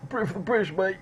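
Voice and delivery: high-pitched inbred lout caricature; mock British nationalist. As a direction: squawk the slogan like a daft patriot. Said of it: "you just get that".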